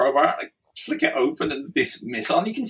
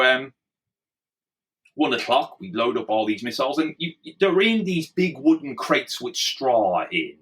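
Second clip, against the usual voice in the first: ordinary talk, nothing marked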